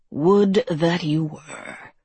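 slow, drawn-out creature voice; wistfully mocking creature. A narrator's reading. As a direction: stretch it out with weary mockery. Creature sighs at the girl.

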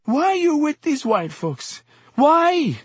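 very high, anguished pleading; sobbing. A narrator's reading. Exhorter standing over the fallen man, sobbing high-pitched plea. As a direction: wail it as a tearful reproach.

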